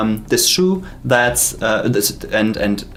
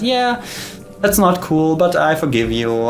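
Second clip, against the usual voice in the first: leprechaun; 7 semitones higher than usual. Acted. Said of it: "And he says".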